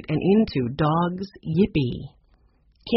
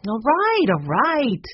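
grudging complaint, the first much higher; complaining; 7 semitones higher, 3 dB louder, slower than usual. A narrator's reading. Shopkeeper forced to unlock the door, grudging complaint. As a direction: give in with a huffy grumble. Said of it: "she complained".

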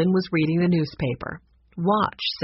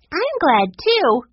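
put-on high, louder girlish voice; happy young girl; 10 semitones higher, 6 dB louder, slower than usual. A narrator's reading. Sue Lee joins the family's gladness, high happy girl voice. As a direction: chime in gladly as a little girl.

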